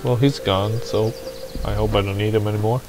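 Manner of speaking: ordinary talk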